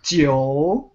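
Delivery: "Jiu" drawn out, low, then rising; forced-air consonant. Shown as a demonstration. Teacher showing tongue position, "Jiu" low and lengthened. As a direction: release "Jiu" from blocked air, dipping the tone. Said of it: "block the air and then you force the air out".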